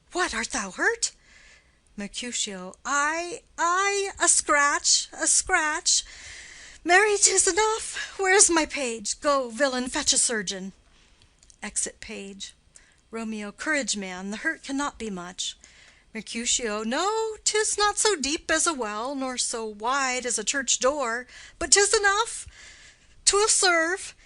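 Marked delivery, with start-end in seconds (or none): 2.8-10.7 higher, louder strained cry of a stabbed man; wounded bravado. Acted. Wounded swordsman shrugs off his wound to his friend, pained cry. 16.8-24.1 bitter wounded jest. Acted. Stabbed man answers his friend, strained pained jest.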